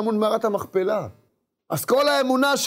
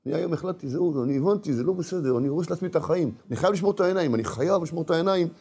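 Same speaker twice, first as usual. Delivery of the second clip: quiet, tense inner vow; tense resolve; 6 semitones lower, 4 dB quieter, faster than usual. Retold or imitated. Man leaving home vowing to guard his eyes, tense and quiet. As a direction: steel yourself under your breath. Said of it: "במתח כזה קרבי".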